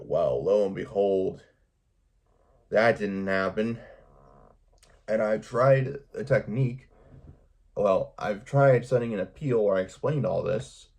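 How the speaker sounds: ordinary talk, nothing marked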